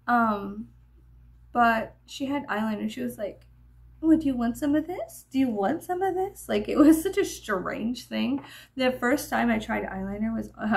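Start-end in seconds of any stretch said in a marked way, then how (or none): 4.0-6.5 high, mocking sing-song offer; mock girly imitation, pitched up; making-fun-of-people voice. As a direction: mimic her with a put-on higher voice. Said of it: "It's like my making fun of people voice".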